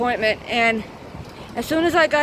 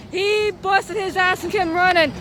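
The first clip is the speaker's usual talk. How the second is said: usual speaking voice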